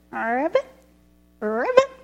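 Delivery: ribbit said like a grandpa's frog call; a grandpa humouring a child with frog noises; frog croak. Acted. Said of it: "make that noise that a frog makes".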